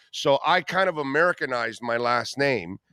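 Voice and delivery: normal talking voice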